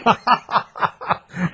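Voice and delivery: high, breathy burst of laughter; real laugh. Real, not acted. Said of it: "I'm laughing".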